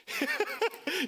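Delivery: high, quick acted chuckle; short burst of high laughter; amused chuckle. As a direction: laugh lightly at someone's shock. Acts out God's amused reply.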